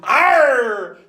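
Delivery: loud, high pirate "Arr!" bellow; pirate arr shout. Acted. Pirate shout ending the song.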